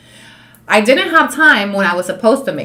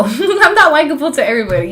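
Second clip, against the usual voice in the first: laughing; 6 semitones higher, 7 dB louder than usual. Real, not acted. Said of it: "laughing about being unlikable".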